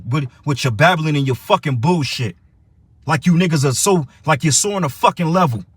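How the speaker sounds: ordinary talk, nothing marked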